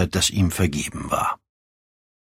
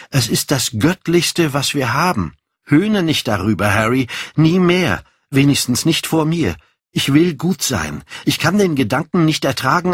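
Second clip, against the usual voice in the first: put-on higher, fervent young Dorian; earnest Dorian; 6 semitones higher than usual. Dorian tells Harry he wants to be good, earnest and fervent. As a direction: plead earnestly in a raised pitch.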